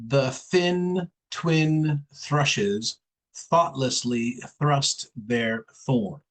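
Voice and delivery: the thin twin thrushes thoughtlessly thrust their thorns, slow and careful, every th with the tongue between the teeth; careful th demonstration; slow tongue twister demo. Shown as a demonstration. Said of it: "let's try this one".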